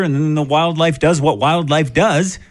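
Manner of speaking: usual speaking voice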